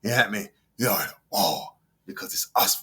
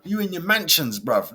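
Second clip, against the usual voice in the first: normal talking voice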